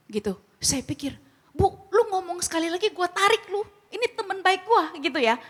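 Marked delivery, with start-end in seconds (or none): 1.5-4.9 furious protective warning; angry outburst. Retold or imitated. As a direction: snap back hard to protect a friend. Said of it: "Emosi lu langsung gitu ya".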